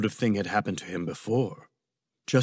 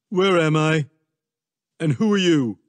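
mildly startled Trump, higher; confused Trump; 4 semitones higher, 5 dB louder than usual. A narrator's reading. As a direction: demand answers a bit louder, lightly in character. Startled Trump demands where he is.

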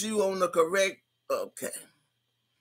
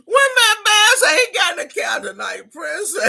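much higher, louder, cracking with laughter; laughing shout; 11 semitones higher, 7 dB louder than usual. Real, not acted. Streamer reads a chatter's couch joke aloud, laughing hard. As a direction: shriek it through laughter. Laughing reading chat comment.

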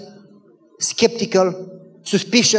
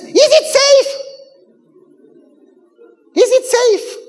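very high, loud mock panic; hammed-up alarm, squeaking up high; 6 dB louder than usual. Wife asking husband about the immigrants, alarmed.

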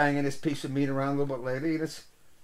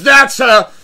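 much louder, higher triumphant cry; excited shout; 9 semitones higher, 15 dB louder than usual. Retold or imitated. Mike confirming to the bar, loud and triumphant. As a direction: nail it like a winning bet. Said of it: "He goes".

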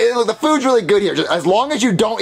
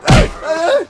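very high frantic plea; panicked begging; 12 semitones higher, slower than usual. Acted. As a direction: beg for protection in terror. Cornered by zombie, begging protection.

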